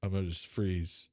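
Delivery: normal talking voice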